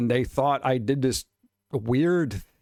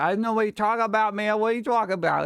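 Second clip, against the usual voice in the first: lightly put-on silly voice, a bit higher; funny voice; 8 semitones higher, faster than usual. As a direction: play the goof to calm an angry guy, mildly. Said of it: "you do a funny voice".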